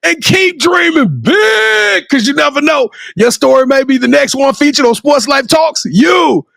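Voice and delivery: high, rousing sign-off, slowing near the end; show outro. Acted. Host signing off to the audience, rousing announcer.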